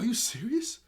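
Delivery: high, loud burst of disbelief; thrilled, pitch leaping up on the question; excited. Retold or imitated. Girl thrilled at news of the antivirus, excited. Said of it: "she's really excited and really pumped".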